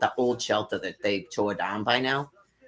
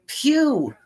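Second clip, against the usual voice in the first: disgusted pew; 5 dB louder than usual. Real, not acted. Reacting to the dog's fart smell.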